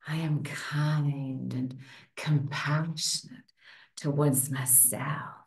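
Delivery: low, soft, slow and sultry; sexiest voice. Shown as a demonstration. Coach jokingly models a self-kindness affirmation in a sexy voice. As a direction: put on a playful sexy voice, deep and drawn out. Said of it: "say it in your sexiest voice".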